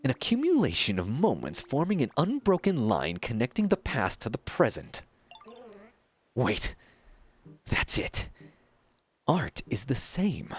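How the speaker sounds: theatrical, self-important painter with sweeping pitch swings; pompous art master. Acted. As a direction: declaim like a grandiose mentor to a disciple. Reads a pompous painter's game dialogue.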